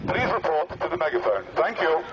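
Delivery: ordinary talk, nothing marked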